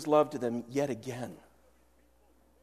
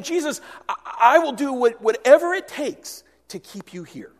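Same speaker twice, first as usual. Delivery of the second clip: desperate plea; 8 semitones higher, 7 dB louder than usual. Retold or imitated. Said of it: "hear the desperation in Peter's voice".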